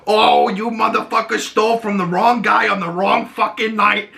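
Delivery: high-pitched, acted-out angry yell; angry threat. Oz getting up on the kid, angry threat. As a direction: tear into the thief with mock fury.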